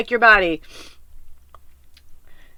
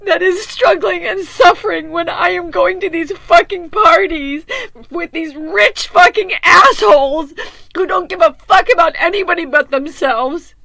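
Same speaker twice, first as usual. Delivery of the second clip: retold outburst; 4 semitones higher, 4 dB louder than usual. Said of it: "I'm like".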